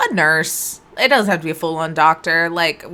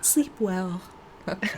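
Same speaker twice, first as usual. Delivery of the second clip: whispering over a crib; 12 dB quieter than usual. Acted.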